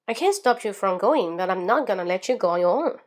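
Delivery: rapid linked sentence without a single pause; fast, in one breath. Shown as a demonstration. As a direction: race through the whole sentence on one breath. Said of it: "以你最快的速度去读". (一口气快速连读，一气呵成)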